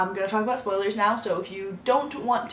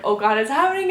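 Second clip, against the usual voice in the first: higher, louder mock panic; retold panic; 5 semitones higher, 5 dB louder than usual. Vlogger reliving the dreaded movie scene, retold panic. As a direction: re-enact rising dread in a rush.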